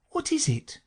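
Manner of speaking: put-on high, tentative girl; Alice. A narrator's reading. Alice ventures a doubt, high and tentative.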